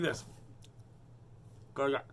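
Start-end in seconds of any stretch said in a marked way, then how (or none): none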